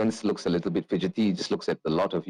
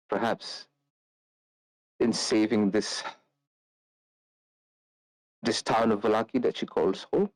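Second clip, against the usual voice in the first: teary plea; 4 semitones higher than usual. Acted. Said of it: "you almost see a tear come down her eye".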